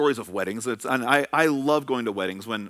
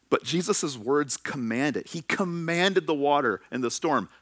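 ordinary talk

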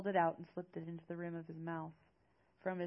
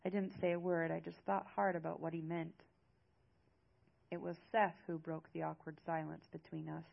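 ordinary delivery, nothing marked

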